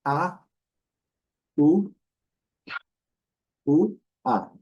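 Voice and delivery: "A, U, U, A" called out loud and separately; teacher splitting "hour" into vowels; vowel breakdown. Shown as a demonstration. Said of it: "Seria mais ou menos isso".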